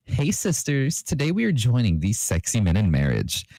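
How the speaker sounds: high, sassy priest voice; sassy beauty guru officiating a wedding, pitched high; James Charles. A narrator's reading. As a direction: camp it up as a gossipy priest. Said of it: "James asked".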